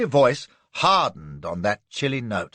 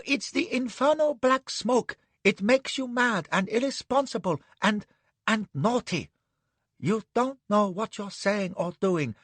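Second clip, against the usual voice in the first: much higher, anxious entreaty; pleading man; 9 semitones higher than usual. Acted. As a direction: beg her to see reason. Anne pleads with her.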